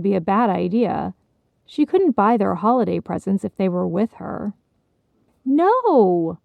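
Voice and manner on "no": playful higher squeal of pretend fright; a hint of a mock scream; mock-fear shriek. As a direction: kids squealing in fake fear. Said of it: "shrieked in mock fear".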